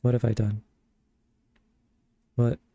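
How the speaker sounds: usual speaking voice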